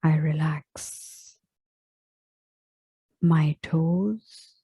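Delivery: very slow meditation voice with long pauses; unhurried body scan, each phrase left to settle; guided relaxation. Real, not acted. Yoga teacher guiding listeners into final relaxation, slow meditative delivery.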